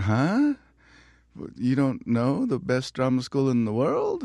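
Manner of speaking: mock-offended young actor, drawn out; incredulous. Young actor at an audition, incredulous and slowed down. His retold disbelief.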